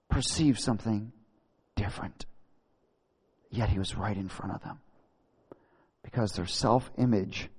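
low, soft, halting explanation; hushed and solemn. Real, not acted. Preacher explaining why they missed him, quiet gravity. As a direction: bring it right down, pausing between phrases.

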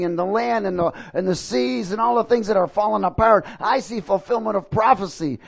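a retold sermon-burst at the weeping woman; fervent preaching. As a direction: pastor preaching prophecy to the crying stranger, fervent.

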